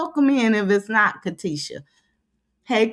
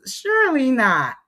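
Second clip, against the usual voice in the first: ordinary talk, nothing marked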